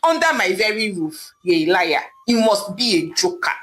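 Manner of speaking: ordinary delivery, nothing marked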